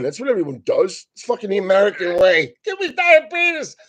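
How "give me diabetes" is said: mock glutton plea. Mocking the American way.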